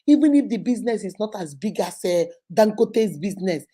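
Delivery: ordinary talk, nothing marked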